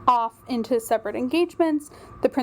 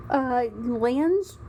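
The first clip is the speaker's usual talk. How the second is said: ordinary delivery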